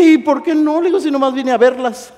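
high, loud protest; mock defensive retort. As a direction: protest loudly as if caught out. Acted defensive reply to sisters.

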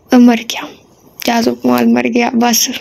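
usual speaking voice

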